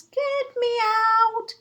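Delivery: high, slow, tiny squeak from afar. Acted. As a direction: squeak small and muffled from inside the snow. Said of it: "squeaking a tiny voice".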